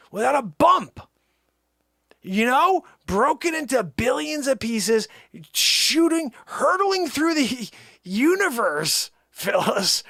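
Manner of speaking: loud, high amazed ranting; high incredulous laughter in the voice. Real, not acted. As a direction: marvel loudly at the absurdity.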